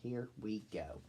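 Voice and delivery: plain reading voice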